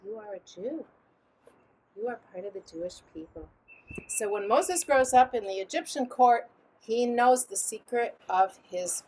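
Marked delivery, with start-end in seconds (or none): 0.0-3.5 put-on hushed, secretive murmur to a baby; much softer, lowered voice; whispering. A narrator's reading. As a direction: pass it on as a quiet secret. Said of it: "she's whispering to him".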